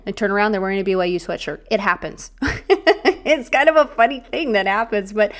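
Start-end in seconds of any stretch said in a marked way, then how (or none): 2.3-3.2 short bright burst of laughter, rising high; amused laugh. Real, not acted. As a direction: laugh at the funny truth of it.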